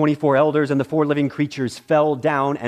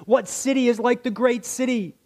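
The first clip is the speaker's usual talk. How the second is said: crying out; 10 semitones higher than usual. A narrator's reading. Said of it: "cried out".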